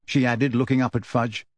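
ordinary delivery

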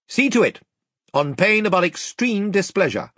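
high, formal royal decree; Caspian, commanding. A narrator's reading. Young king reprimanding the captain over his men, commanding. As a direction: lay down orders in a ringing kingly voice.

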